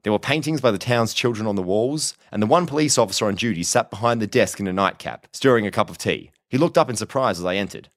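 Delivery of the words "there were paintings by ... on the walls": plain reading voice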